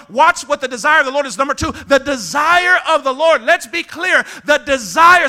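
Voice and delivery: high, loud, insistent preaching; full-force preaching. Real, not acted. Preacher pressing the Lord's desire, full-force preaching. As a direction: drive the point home, rising in force.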